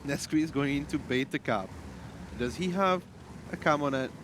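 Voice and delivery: ordinary delivery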